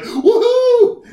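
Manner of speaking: put-on very high, loud squeal of a thrilled kid; imitating excited daughter. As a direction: shriek it like a delighted toddler. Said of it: "She'd be like".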